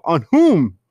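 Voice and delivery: imitating an annoyed coach barking a question; pissed off. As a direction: challenge the ref in a huff. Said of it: "Ron is, like, so pissed off".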